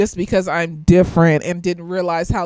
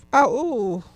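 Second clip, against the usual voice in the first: usual speaking voice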